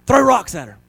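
high, loud mob cry; crowd crying out. Acted. Crowd yelling at the adulteress, high and loud. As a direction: shout it like a bloodthirsty crowd. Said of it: "They're crying out".

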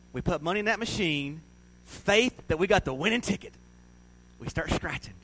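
ordinary talk, nothing marked

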